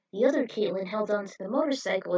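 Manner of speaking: usual speaking voice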